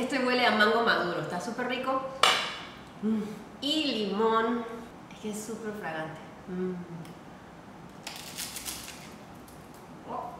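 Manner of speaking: speaking while smelling food; chip held at lips. Real, not acted. Seen 0: Eyes closed, sniffing chip at lips; biting only starts after +2.6.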